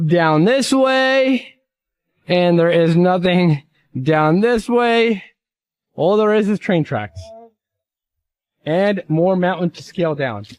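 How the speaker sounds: vlog tour narration. Acted. Guiding viewers through the scene.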